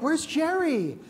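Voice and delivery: high, puzzled question; Muhammad asking. Retold or imitated. Muhammad back home asking his wife about the guest, high and puzzled. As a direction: ask where the guest went, voice up high. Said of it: "be like".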